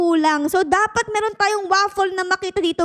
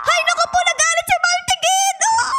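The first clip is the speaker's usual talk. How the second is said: alarmed; 8 semitones higher than usual. Real, not acted. Player alarmed as Maltigi gets angry, high yelp. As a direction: yelp as the monster turns on you. Pet monster turns angry.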